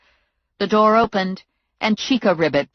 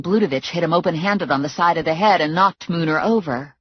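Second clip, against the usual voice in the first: plain reading voice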